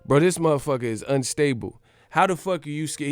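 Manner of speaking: usual speaking voice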